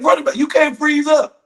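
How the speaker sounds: high, loud shout at a player; high-pitched, urgent sideline holler; coach yelling. Acted. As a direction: yell it like a coach on the field. Imitated coach yells at player.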